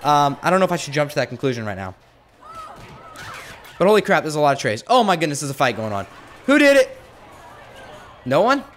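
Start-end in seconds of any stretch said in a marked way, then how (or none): 6.5-8.6 high, loud demand; demanding shout. Pretend. Warden demanding answers from the brawling inmates, loud and high. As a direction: call out who did it. Demanding who started the fight.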